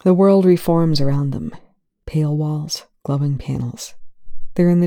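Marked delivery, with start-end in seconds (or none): none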